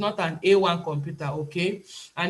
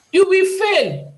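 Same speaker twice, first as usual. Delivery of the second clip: high, loud, slow and emphatic; stern warning; 12 semitones higher, 9 dB louder, slower than usual. Real, not acted. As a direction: hammer the warning home. Warning students about fake accents.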